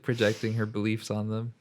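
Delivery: ordinary talk, nothing marked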